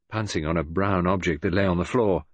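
ordinary delivery, nothing marked

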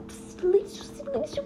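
urgent hushed whisper; whispered silêncio. Acted. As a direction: shush him before he tells. Hushing the father.

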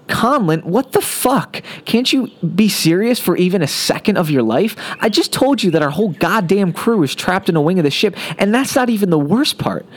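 high, exasperated outburst. A narrator's reading. Engineer loses patience with joking crewmate, exasperated. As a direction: snap at him, furious he won't be serious.

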